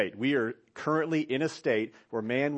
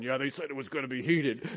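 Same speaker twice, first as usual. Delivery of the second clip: mock other guy. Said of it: "he's standing there going".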